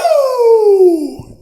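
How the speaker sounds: high, loud hype whoop to kick off the show; excited woo. Real, not acted. Host kicking off the podcast, excited loud whoop. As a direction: whoop it up like the show just started. Hype intro after clap count.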